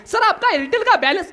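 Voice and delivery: very high-pitched telemarketer imitation; phone saleswoman. Company woman phoning about a balance, high imitated sales voice. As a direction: put on a company caller's high sales lilt. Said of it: "ती बाई म्हणली".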